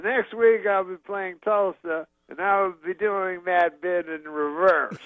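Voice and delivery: high, slow comedian impression; Bill Cosby. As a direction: stretch it out like a stage announcement. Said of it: "at the end he goes".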